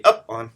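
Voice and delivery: "up on" with "up" punched loud; heavy "up", lighter "on" in "up on"; trochaic stress. Shown as a demonstration. Teacher illustrating the trochee for class, "up on" stressed loudly on "up". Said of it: "which is the reverse".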